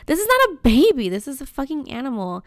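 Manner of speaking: loud mock telling-off; mock scolding. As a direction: snap at the lady, half joking. Scolding the cooing neighbor.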